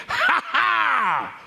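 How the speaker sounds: high, hammed-up jeering cackle; exaggerated villain's "ha ha"; mocking laugh. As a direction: laugh them out of town. Nobles jeer as they send men off.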